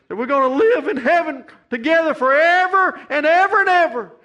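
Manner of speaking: high, loud climactic preaching, rising to a cry; full-force preaching. Acted. Preacher promising heaven to the grieving church, full-force preaching. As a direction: lift the congregation up to heaven, shouting it out.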